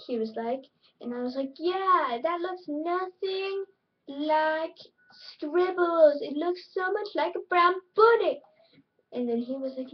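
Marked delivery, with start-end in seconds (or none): 1.6-8.4 annoying opposite self. Retold or imitated. Opposite self retelling a squabble, hammed-up insistence. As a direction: insist on it like an annoying know-it-all. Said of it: "how annoying my opposite self was".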